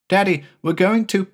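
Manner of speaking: lightly voiced young son; little boy Jamie. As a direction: ask like a small boy puzzled.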